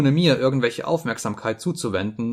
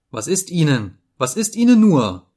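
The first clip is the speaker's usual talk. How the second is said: high cry, growing much louder; alarmed cry; 5 semitones higher, 6 dB louder than usual. A narrator's reading. Frightened woman tending the sobbing man, alarmed cry. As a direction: call out in mounting alarm. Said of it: "rief sie und bemühte sich".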